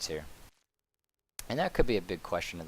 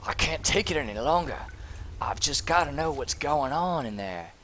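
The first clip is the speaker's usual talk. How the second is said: frantic overlord voice; 4 semitones higher, 4 dB louder than usual. Acted. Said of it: "He's like".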